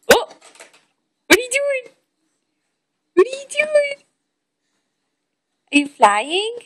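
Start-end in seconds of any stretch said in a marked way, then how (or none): 0.1-4.0 high cooing voice. Real, not acted. Parent coos at toddler.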